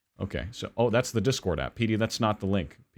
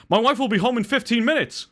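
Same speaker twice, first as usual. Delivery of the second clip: much higher, urgent panicked cry; frenzied; 12 semitones higher than usual. Acted. Husband tells the burglar in a frenzy, panicked. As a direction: blurt the punchline in a panic. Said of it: "in a state of frenzy".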